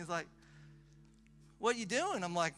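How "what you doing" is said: loud, high Aussie country imitation; Australian country-boy accent. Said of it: "real, like, country boy".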